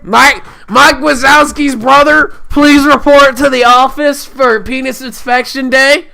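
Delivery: high, loud school PA announcement; over the intercom. Pretend. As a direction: announce it like the principal.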